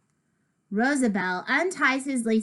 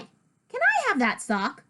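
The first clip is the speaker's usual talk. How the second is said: higher, eager request; eager ask; 8 semitones higher than usual. Acted. Narrator asking George for sock, eager higher ask.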